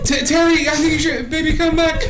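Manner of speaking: high, stammering mock plea; feeble persuasion. Acts player's failed persuasion attempt.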